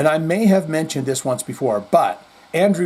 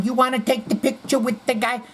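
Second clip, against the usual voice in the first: higher, pushy hustler voice; Andrew Dice Clay; 9 semitones higher than usual. Retold or imitated. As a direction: keep pushing the stranger like a brash comic. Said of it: "he keeps going".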